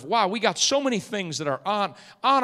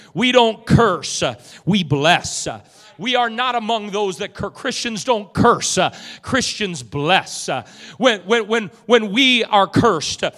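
loud, high, punchy pulpit cadence; full-force preaching; 7 semitones higher, 13 dB louder than usual. Real, not acted. Preacher urges church to bless enemies, full-force preaching. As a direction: hammer each contrast, stretching the key words.

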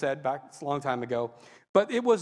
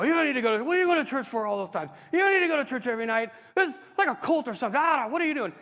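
raised, higher mock angry dad; dad yelling; 7 semitones higher, 4 dB louder, faster than usual. Dad yelling at his newly saved son over church, mock angry rant. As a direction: imitate an exasperated father's rant. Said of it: "he started yelling at me".

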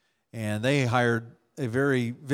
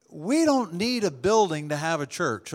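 ordinary delivery

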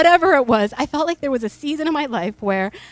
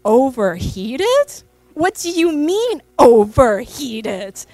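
mock indignant mother; 6 dB louder, much slower than usual. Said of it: "I look at him and I say".

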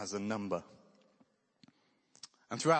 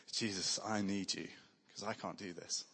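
quiet prayer; 4 semitones lower, 6 dB quieter than usual. Retold or imitated. Acting a desperate toilet prayer.

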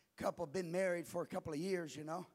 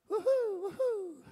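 high, loud whooping; mock preacher whoop; 11 semitones higher, 6 dB louder than usual. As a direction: imitate a young preacher hollering. Mimics young preacher's whoop.

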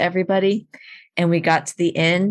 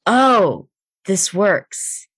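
delighted discovery; 2 semitones higher, much slower than usual. Retold or imitated. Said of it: "she was like".

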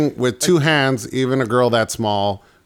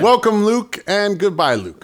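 high mock game-show host; mock announcer; 9 semitones higher than usual.

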